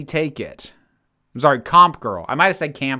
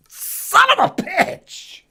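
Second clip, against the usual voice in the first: slow, high, disgusted swearing; disgusted high curse; 4 dB louder, slower than usual. Real, not acted. Nasty jelly bean taste.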